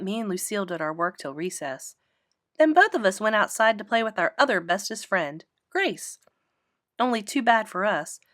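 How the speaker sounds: usual speaking voice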